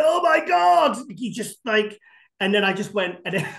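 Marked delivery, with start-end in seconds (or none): 0.0-1.0 high, loud awestruck cry; shocked shout. Retold or imitated. Reacting to Rubiel walking out at the show, a high awestruck shout. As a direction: shout it out, amazed by what you see. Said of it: "I kinda shouted it loud".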